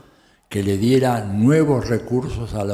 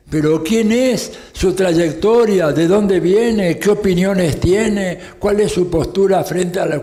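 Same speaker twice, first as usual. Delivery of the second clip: higher, slow and louder questions; indignant questioning; 6 semitones higher, 4 dB louder than usual. Real, not acted. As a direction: demand answers, outraged. Indignant questions about the electors.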